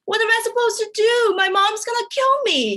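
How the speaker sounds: high-pitched wail of a panicked friend, hammed-up; mock crying. Pregnant friends coming over to [name], mock crying. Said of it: "came over crying to me".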